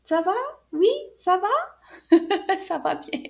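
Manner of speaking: cheerful puppet reply, laughing; Anana's voice. Acted. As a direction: answer brightly as the little puppet. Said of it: "Anana dit à la prochaine".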